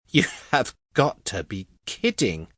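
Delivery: astonished woman's voice; wide-eyed disbelief. A narrator's reading. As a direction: react as if you can't believe it. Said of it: "Annie's eyes went wide at that".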